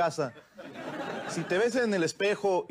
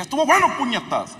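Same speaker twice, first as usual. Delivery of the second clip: angry outburst; 8 semitones higher, 6 dB louder than usual. Retold or imitated. Angry at the gringo.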